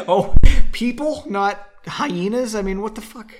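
a loud, laughing "people?"; laughing disbelief. Real, not acted. Reader laughing in disbelief at the book's padding. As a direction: scoff at it through a laugh.

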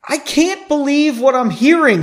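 high, loud, frantic disbelief. A narrator's reading. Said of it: "he said frantically".

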